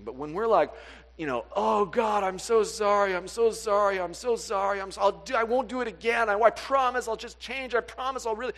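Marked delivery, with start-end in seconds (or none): 1.5-8.5 loud, higher, rushed apologies tumbling out; frantic pleading. Retold or imitated. Preacher acting a guilt-ridden believer begging God, loud and frantic. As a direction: beg God desperately, over and over.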